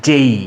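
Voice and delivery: "যেই" gliding from e into i; corrected letter name. Shown as a demonstration. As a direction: end "যেই" on a clear ee. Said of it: "কি হলো উচ্চারণ?".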